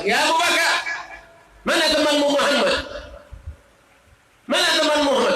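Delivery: a furious woman calling out for Muhammad; angry demand. Acted. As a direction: confront him, spitting the question. Ummi Jamil angrily demanding Muhammad.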